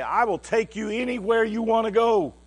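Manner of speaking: high, loud sweeping declaration; grand promise. Retold or imitated. Promising his brother a holiday treat, loud and grand.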